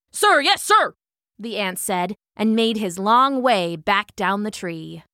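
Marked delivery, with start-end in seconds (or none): 0.1-0.9 high, loud, crisp salute; little soldier ant. A narrator's reading. Ant dismissed by the old hornet, crisp soldier voice. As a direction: snap it out high and smart. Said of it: "he piped in his little voice".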